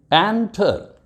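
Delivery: Enter with एन pitched high, then falling; stress on first syllable. Shown as a demonstration. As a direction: say enter as EN-ter. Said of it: "stress है एन पर".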